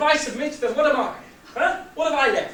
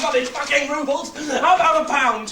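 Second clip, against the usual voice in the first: loud, fast, angry swearing; man swearing at the guard over rubles; exasperated outburst; 9 dB louder than usual. Acted. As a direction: snap it in frustration.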